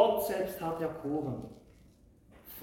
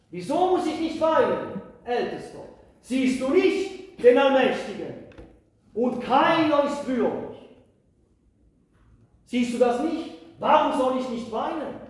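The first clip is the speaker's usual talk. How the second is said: loud, pleading protest; John protesting; 6 semitones higher, 8 dB louder than usual. Acted. John asks the elder why not weep, loud pleading. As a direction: demand an answer through grief.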